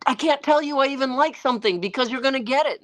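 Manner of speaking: high, quick retold protest; mock exasperated complaint. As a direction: protest fast and high, half amused. Imitates his exasperated teasing complaint.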